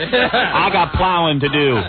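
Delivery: put-on slow hillbilly accent, stretched out; mock him as a hillbilly ploughman; hillbilly farmer. Mocking the hillbilly speaker after clip.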